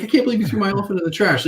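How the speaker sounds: high, fast hammed-up indignation; mock outrage. Scolding the receptionist for binning it, fast mock outrage. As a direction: pretend to be offended, playfully. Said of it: "I'm like".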